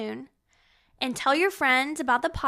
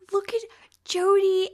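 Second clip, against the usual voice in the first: excited gasp; 8 semitones higher, 5 dB louder than usual. Real, not acted. Excited reaction to old photos.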